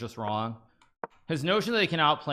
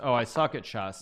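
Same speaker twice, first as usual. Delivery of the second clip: ordinary talk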